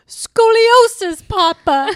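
high, slow, loud quoting voice; drawn-out high quote; character voice. Retold or imitated. Quoting movie line in character.

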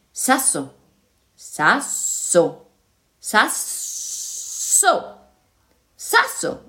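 hissed, drawn-out s, breaking into whisper; talking stone. A narrator's reading. Stone saying its name to two listeners, hissing stone voice. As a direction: say it slowly with a long hissing s.

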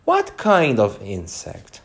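plain reading voice

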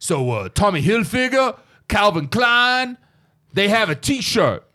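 drawn-out tough-guy sermon with laughs; Mister T impression. Mister T preaching to kids about designer t-shirts, in a hammed-up slow voice. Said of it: "yo, he's like".

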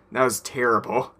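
ordinary talk

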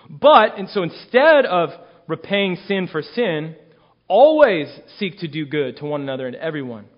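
stressing always and everyone; read with force. Shown as a demonstration. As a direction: drive each word home. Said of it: "look what he says here".

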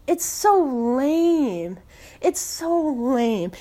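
very slow, stretched-out complaint; drawn-out disgust. Real, not acted. Fan lamenting a lost game, drawn-out disgust. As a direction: drag out each word in exasperation.

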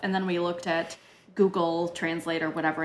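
normal talking voice

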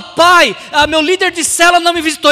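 high, loud, hurried excuse; making excuses. Acted. Son blaming his cell leader to father, hurried loud excuse. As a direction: blame someone else loudly and quickly. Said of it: "Ele não fala".

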